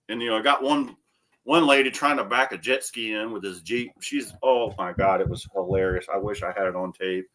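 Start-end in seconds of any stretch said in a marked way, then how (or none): none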